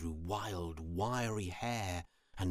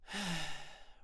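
long, breathy sigh; 8 semitones higher, 3 dB quieter than usual. A narrator's reading. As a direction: let out a high, airy sigh. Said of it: "and spider sighed".

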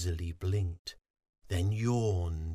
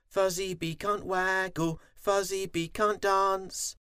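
high, sing-song teasing; high-pitched playground jeer; sniggering bees; 11 semitones higher than usual. A narrator's reading. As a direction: taunt like mocking little bees. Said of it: "The other bees sniggered".

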